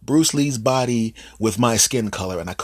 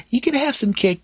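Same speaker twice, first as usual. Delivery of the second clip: put-on much higher, sweet toddler voice; little girl voice. Little niece offering her uncle cake, high child voice. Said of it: "the baby comes up to you".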